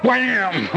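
playful bang with a laugh; mock wham. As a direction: act out the blow, laughing. Boom hits his head.

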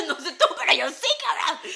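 laughing loudly through the words. Real, not acted. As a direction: laugh it out, loud and cocky. Laughing boast to interviewer.

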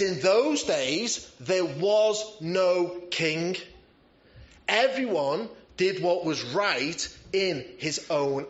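slow, weighty scripture quote, each phrase given room; slow solemn reading. Acted. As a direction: lay out the verse slowly and gravely.

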